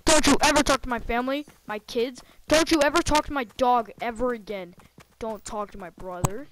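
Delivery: loud furious warning; angry shouting. Real, not acted. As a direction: yell the threats at the stalker. Yelling threats at the stalker.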